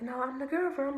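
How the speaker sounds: mild mock girlfriend voice; girlfriend's reply. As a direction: say it like the other girl correcting her. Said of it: "And then she was like".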